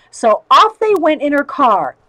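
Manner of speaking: plain reading voice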